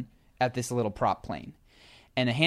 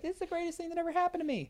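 gushing cult member; 6 dB quieter, faster than usual. Retold or imitated. People on record gushing about cult.

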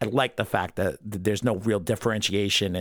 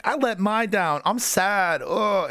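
higher, whiny mock sadness; hammed-up mopey sulk, pitched up; imitating a dejected hero's higher moan; 8 semitones higher, slower than usual. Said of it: "Future Trunks is visibly dejected".

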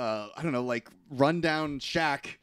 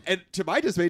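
ordinary talk

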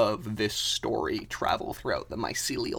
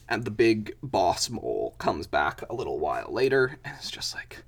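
ordinary talk

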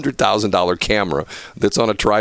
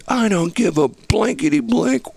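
high-pitched, exasperated director's tirade; retold angry order, strained and high; imitated cussing out. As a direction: chew out the cameraman, voice pitched high. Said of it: "he cusses at me".

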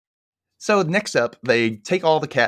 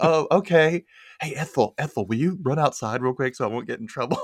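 laughing sneaky request; 6 semitones higher than usual. Retold or imitated. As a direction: giggle while coaxing the pig out. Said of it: "And she's".

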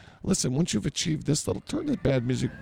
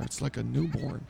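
usual speaking voice

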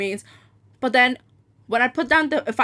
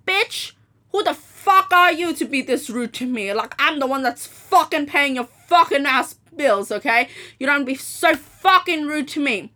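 higher-pitched acted angry comeback; indignant telling-off; 3 semitones higher than usual. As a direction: re-enact scolding with punched swear words. Retelling her angry comeback.

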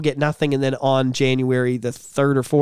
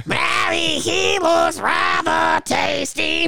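slow, much higher silly voice; hammed-up cartoon voice; 13 semitones higher, much slower than usual. Host quoting Aladdin for laughs, slow high voice. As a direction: draw it out like a cartoon lady.

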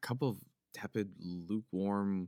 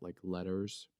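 ordinary delivery

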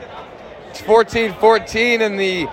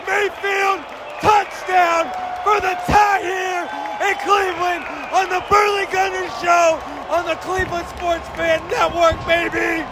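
touchdown call; 6 semitones higher than usual. Real, not acted.

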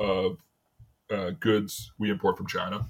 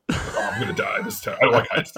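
half-laughed, breathless through giggles; laughing; 9 semitones higher than usual. Real, not acted. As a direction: laugh through a mock panic. Laughing about scary guardrail.

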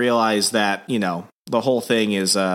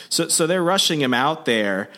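ordinary talk

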